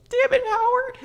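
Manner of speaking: high, squealed mock reproach; mock anguish. Fan to the dub actor who made them cry, mock anguish. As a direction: wail at the one who made you cry. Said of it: "I was just like".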